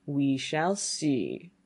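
very slow, drawn-out challenge; ominous old man. Acted. Old man answers the youth's boast.

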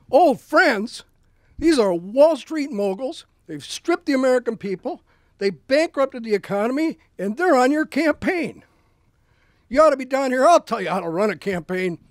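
Huey Long chewing out Roosevelt on the phone, loud and indignant; angry Huey Long. Retold or imitated. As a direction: bellow at the governor like a riled-up populist. Huey Long scoffing, ranting back.

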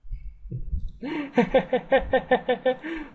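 high, drawn-out villain laugh; evil cackle. Acted. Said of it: "I'm sound evil here".

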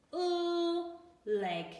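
"Oo" sung high and long, then "leg"; oo sound. Shown as a demonstration. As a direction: stretch "Oo" up high before "leg". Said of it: "Today's sound we're going to learn is".

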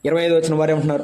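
usual speaking voice

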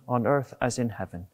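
usual speaking voice

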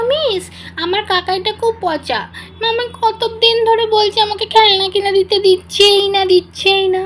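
put-on high child voice, complaining and sulky; whiny little girl. Rimi complaining to her tutor about her uncle, whiny. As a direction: tattle on the uncle like a pouting kid.

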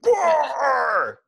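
high, loud stadium howl of the name; announcer yell. Shown as a demonstration. Showing how Gwar must be said, booming announcer shout. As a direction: belt the band name to a crowd. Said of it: "you can't say Gwar in any other voice".

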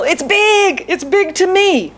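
pitched high, fretting over a big problem; lightly voiced anxious churchgoer; mock worried believer. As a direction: play up how huge it feels.

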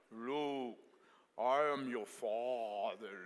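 slow, drawn-out movie villain impression; James Earl Jones. Preacher showing the congregation a notable voice, James Earl Jones impression. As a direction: do a slow, weighty famous-actor voice. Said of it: "that's James Earl Jones".

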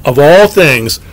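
usual speaking voice

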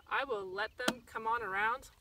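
normal talking voice

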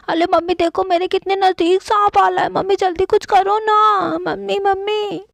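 put-on child begging her mother to save her from the snake; crying for mom. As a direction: whimper for mummy as the snake nears. Snake creeping closer to child.

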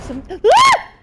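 sudden high yelp of fright; startled scream. Real, not acted. Player jolted by a jump scare, screaming. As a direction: shriek as something jumps out.

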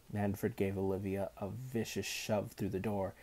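plain reading voice

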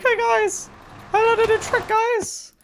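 very high, squeaky mock kid voice; mocking impression. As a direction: imitate an eager show-off, loud and squealing. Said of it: "He's just like".

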